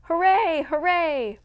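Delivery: put-on high, loud little-girl piping; piping cheers. Little girls running out to the commotion, piping cheer. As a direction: pipe it like small girls running out. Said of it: "piped Hetty and little Minnie".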